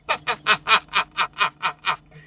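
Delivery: loud laughing burst; real laugh. Real, not acted. Laughs after saying just deaf.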